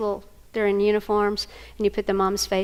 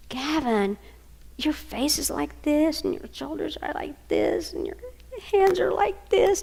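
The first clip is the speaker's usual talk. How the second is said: gentle; 6 semitones higher, slower than usual. Real, not acted. Grandma mirrors crying boy gently.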